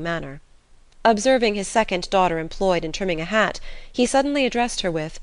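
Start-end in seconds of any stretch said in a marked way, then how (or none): none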